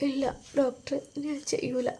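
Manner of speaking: soft, weak promise; patient promising the doctor, faint and soft; faint whisper. Acted. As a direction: murmur it, too tired to push.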